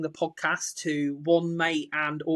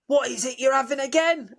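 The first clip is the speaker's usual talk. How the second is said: high, leaning-in bartender voice; mock bartender; 9 semitones higher than usual. Bar worker leaning over the bar, in a high mock voice. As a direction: shout it over the music like a barman. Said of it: "and they go".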